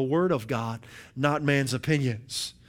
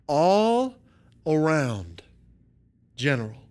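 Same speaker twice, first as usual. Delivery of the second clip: slow, spaced-out list with long pauses; dramatic definition reading; slower than usual. Acted. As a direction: let each phrase land before the next. Reads a definition item by item.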